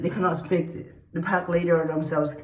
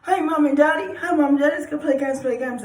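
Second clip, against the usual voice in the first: much higher, louder eager plea; the dog begs Mom and Daddy to play, excited; 10 semitones higher, 5 dB louder than usual. Pretend. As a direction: beg to play like an excited puppy. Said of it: "I'm excited for the game".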